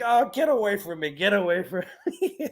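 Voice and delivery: put-on teasing grumpy old friend; grumpy brush-off. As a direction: play the curmudgeon shooing him away. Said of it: "He's like".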